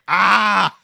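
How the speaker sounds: loud sudden "Ah!" shout; mock burst of a yell; imitated stage yelp. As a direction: belt out a quick startled "Ah!". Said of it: "just goes".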